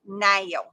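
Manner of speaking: "now" said as "nayl"; imitated mock-Southern now. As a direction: playfully mimic "now" sounding like "nail". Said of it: "trying to sound Southern".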